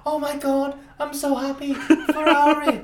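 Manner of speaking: high, squealing overjoyed fan; gushing joy. Retold or imitated. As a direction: gush like a giddy fan, laughing at the end. Friend's gushing Ferrari message retold.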